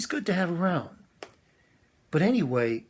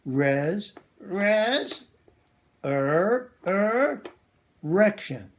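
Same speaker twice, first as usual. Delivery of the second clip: halting puppet voice; 5 semitones higher, 3 dB louder, much slower than usual. Acted. Puppet stumbles over hard word.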